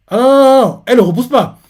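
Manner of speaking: loud, high burst of no no no; a sudden real outburst catching his own error; startled correction. Real, not acted. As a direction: shout it as you realise you got it wrong. Realises he told it wrong.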